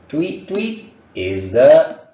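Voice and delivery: high, loud, slow tweeting like a bird; bird tweet imitation. Shown as a demonstration. Teacher imitating a bird for students, high tweet. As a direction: chirp it like a little bird. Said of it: "bird sound demonstrated".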